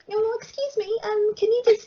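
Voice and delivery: high, sweet fake-polite voice; mock-polite lady. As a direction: play it sickly polite. Mocking polite request to catcaller.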